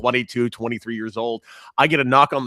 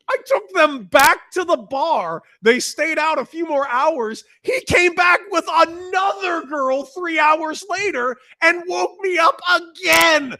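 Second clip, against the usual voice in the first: high, slow, laughing shout of disbelief; half-shouted laughing recap; laughing exasperation; 6 dB louder, slower than usual. Real, not acted. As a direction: laugh through the story's punchline. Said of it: "Dude, baseball players are the worst".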